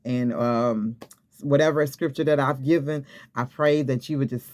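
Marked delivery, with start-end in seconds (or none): none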